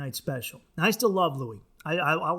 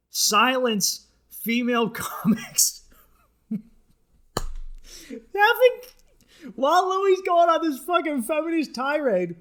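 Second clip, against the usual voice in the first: laughing incredulity; 8 semitones higher, 4 dB louder, slower than usual. Real, not acted. Laughing outrage.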